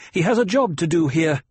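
loud, high, bitter retort; snapped. A narrator's reading. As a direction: snap it out angrily. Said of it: "Jessica snapped".

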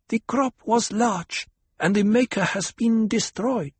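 put-on a girl priestess asking solemnly; young girl voice. A narrator's reading.

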